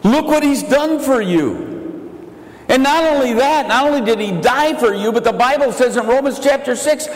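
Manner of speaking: full-force pulpit preaching; fervent preaching. Acted. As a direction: preach it out to the crowd.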